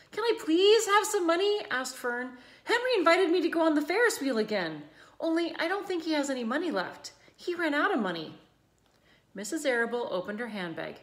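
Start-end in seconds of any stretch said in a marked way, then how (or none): 0.1-8.4 put-on high, fast girlish begging; eager plea. A narrator's reading. Girl begging her mother for money, fast and eager. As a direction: rush it out, eager to go.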